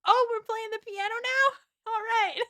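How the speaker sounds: very high, drawn-out delighted voice; mock eager excitement. Frank at the party joining the piano, eagerly excited. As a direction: pipe up high like someone thrilled to join.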